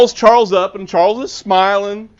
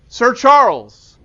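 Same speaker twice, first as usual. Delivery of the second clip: warm greeting; 4 semitones higher, slower than usual. Retold or imitated. Calling out from car window.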